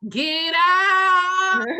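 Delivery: high sung shout; straining shout. Pretend. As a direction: belt it out like a mom pushing. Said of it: "And mama saying".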